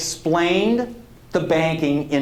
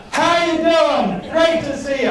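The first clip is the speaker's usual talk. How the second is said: much higher, louder, big hearty radio greeting; hearty radio-host hello, pitched way up; booming AM radio voice; 10 semitones higher, 4 dB louder than usual. Retold or imitated. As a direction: boom it out like an old-time AM host. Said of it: "that's an A M voice".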